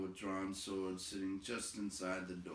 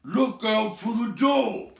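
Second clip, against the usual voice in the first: far higher, louder, slow bellow; bellowing goblin captain; 14 semitones higher, 18 dB louder, slower than usual. A narrator's reading. Goblin captain ordering guards to the door, bellowing. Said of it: "bellowed the captain".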